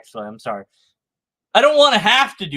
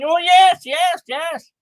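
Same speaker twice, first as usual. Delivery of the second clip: high, eager mock yes from an apologist; imitating a smug apologist's eager yes; 9 semitones higher, slower than usual. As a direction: pitch it up like a smug apologist agreeing. Apologist voiced in mock dialogue.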